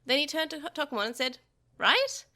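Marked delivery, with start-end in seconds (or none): 1.8-2.2 put-on child voice. A narrator's reading. Child asks Tokomon.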